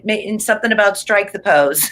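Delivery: ordinary talk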